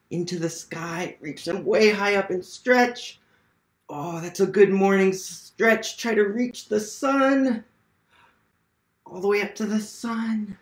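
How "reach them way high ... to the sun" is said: higher, lively cheering on; excited encouragement. Real, not acted. Educator urging children to stretch toward the sun, higher excited encouragement. Kids stretching along with reader.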